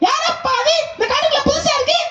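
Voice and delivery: squeaky high animal figure, quick and wary; high giraffe voice. Acted. Giraffe asks the goat who is new, high voice.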